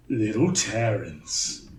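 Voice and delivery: low, slow, menacing giant voice. Acted. Huge tank creature taunting the party, low and slow. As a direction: taunt the little Terrans.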